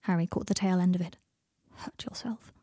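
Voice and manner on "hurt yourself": very quiet mutter, almost a whisper. A narrator's reading. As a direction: mutter it under your breath, barely voiced. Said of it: "He muttered something else".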